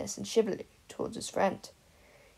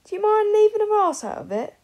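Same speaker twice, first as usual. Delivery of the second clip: much higher and louder, sharp retort; snapping; 14 semitones higher, 11 dB louder than usual. Acted. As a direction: snap back from a few rows up. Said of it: "Mary snapped".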